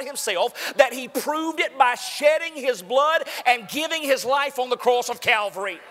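loud, high, driving pulpit voice; preaching at full force. Acted. Preacher to congregation, full-force sermon shout. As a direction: thunder it out to the congregation.

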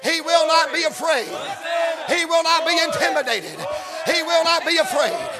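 shouted, emphatic preacher's delivery; fervent sermon climax; full-force preaching. Real, not acted. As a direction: declare each phrase firmly over a chanting crowd. Preaches over the chanting crowd.

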